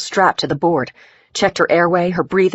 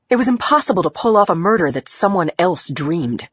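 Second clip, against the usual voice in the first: ordinary delivery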